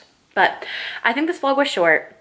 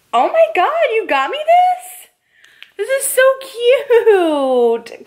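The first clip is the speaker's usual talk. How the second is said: amazed gasp; 12 semitones higher, 4 dB louder than usual. Real, not acted. Surprised by the gift.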